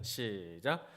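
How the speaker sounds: usual speaking voice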